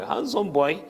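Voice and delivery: "Handsome boy" lifted high, with a mocking lilt; ironic handsome boy. Shown as a demonstration.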